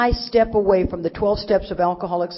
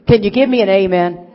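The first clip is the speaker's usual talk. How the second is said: louder, rousing the crowd like a revival; loud preacher call; 10 dB louder than usual. Acted. Preacher rousing the meeting crowd with a loud call. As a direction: call out loud to the room for an amen. Calls for amen from audience.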